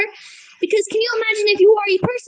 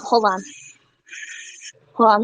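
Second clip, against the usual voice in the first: ordinary delivery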